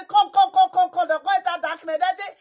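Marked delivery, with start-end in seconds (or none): 0.1-1.1 calling out. Acted. Calling someone into darkness.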